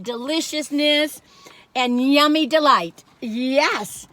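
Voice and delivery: ordinary talk, nothing marked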